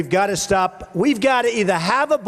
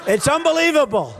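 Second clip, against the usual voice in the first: ordinary talk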